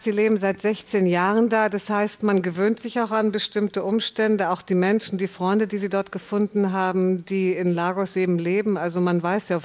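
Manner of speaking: ordinary delivery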